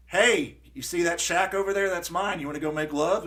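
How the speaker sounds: loud, high, hammed-up come-on; a woman on a bicycle calling out a cheeky offer; mock woman's pickup line.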